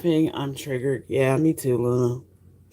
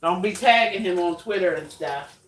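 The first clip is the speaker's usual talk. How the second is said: ordinary talk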